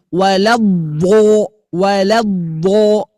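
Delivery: waladdo said as walabo, slow and loud; mock a student's waladdo with pursed lips; imitating a wrong reading. Teacher imitates students mispronouncing waladdo. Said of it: "ada yang bacanya gini".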